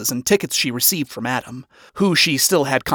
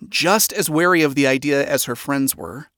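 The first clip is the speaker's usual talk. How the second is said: ordinary delivery, nothing marked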